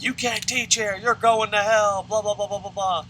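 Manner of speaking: high, loud mock scolding; hammed-up rant running off into babble; imitated angry tirade. Mad colleague condemning the atheist teacher, mock tirade. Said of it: "and she's mad".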